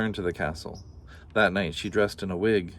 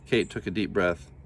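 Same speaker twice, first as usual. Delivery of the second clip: plain reading voice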